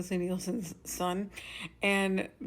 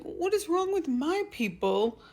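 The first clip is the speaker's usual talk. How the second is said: exasperated outburst; 8 semitones higher than usual. Retold or imitated. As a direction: rant at your neighbours in disbelief. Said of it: "I was upset".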